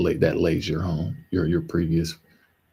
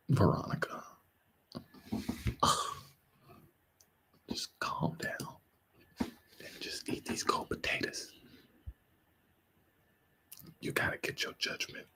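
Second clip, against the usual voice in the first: mock soothing whisper; 3 semitones higher, 13 dB quieter than usual. Telling the Karens to calm down, mock-soothing whisper. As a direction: hush them like a nanny, mocking.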